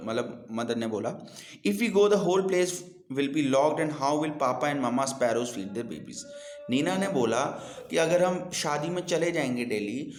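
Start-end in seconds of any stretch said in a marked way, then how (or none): 1.7-6.2 put-on slow, clear reading to children; slow deliberate read-aloud for young learners; storybook reading. A narrator's reading. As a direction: read the story slowly and carefully. Reads English story aloud.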